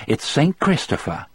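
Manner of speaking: lightly voiced old lady offering tea; cheerful woman. A narrator's reading. Melinda voiced.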